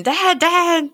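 high mock cry for Dad; high-pitched, half-voiced scream; retold scream. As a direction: imitate a boy screaming for his father. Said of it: "And Walt's screaming".

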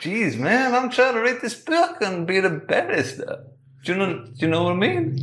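whiny annoyance at being pestered; exasperated. Acted.

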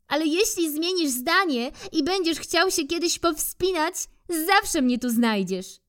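high squirrel calling down. Acted. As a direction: call after the bear from the tree, pitched high. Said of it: "zawołała raz jeszcze".

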